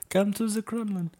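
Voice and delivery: very high, loud, slow cartoon Russian; a silly puppet Russian inviting to the Kremlin; squeaky Kremlin puppet voice; mock Russian voice. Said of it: "not even sure that was Russian".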